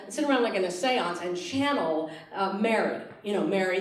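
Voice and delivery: ordinary delivery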